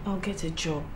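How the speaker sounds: soft apologetic voice to husband; timid quiet confession. Acted. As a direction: confess it almost under your breath.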